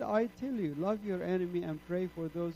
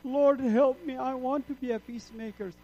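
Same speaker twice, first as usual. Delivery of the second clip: raised, pleading cry to the Lord; pleading prayer; 7 semitones higher, 6 dB louder than usual. Retold or imitated. Convert retelling his tearful prayer, pleading loudly. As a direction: beg the Lord to be his child.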